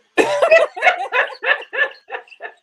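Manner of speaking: loud high laugh; real laugh. Real, not acted. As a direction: laugh with delight. Laughs after taking affirmation.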